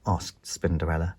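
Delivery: ordinary delivery, nothing marked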